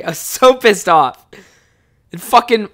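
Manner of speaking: ordinary talk, nothing marked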